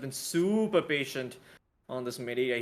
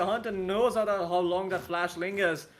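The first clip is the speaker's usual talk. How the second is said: raised, higher caster voice; animated live esports commentary; urgent play-by-play; 5 semitones higher, 3 dB louder than usual. Real, not acted. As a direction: call the danger as it unfolds.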